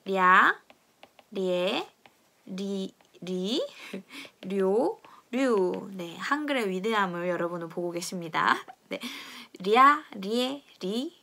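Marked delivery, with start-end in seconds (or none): 0.1-5.9 랴 리에 리 료 류, each syllable said slowly and apart; slow demo of 랴, 리에, 료, 류; Portuguese lh sounds. Shown as a demonstration. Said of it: "어떤 소리가 나냐면". 9.6-11.2 랴, 리에, 리, 료 stretched out slowly; slow the syllables right down for the class; slow syllable drill. Shown as a demonstration. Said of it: "류로 발음이 됩니다".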